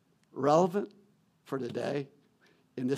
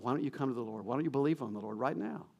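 ordinary talk, nothing marked

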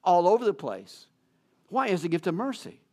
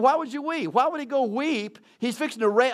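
puzzled; 6 semitones higher than usual. Retold or imitated. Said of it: "And I'm thinking".

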